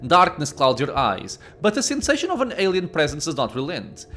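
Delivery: measured storyteller reading; story narration. A narrator's reading. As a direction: narrate the scene like an audiobook. Reads game narration.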